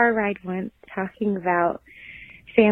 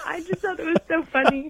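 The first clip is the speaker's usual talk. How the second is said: laughing; 10 semitones higher than usual. Real, not acted. Laughing at husband story.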